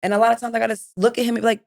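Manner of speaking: normal talking voice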